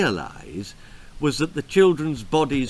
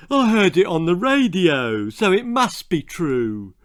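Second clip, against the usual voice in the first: half-witted husband voice; 4 semitones higher, 4 dB louder than usual. Acted. Husband character voiced in story.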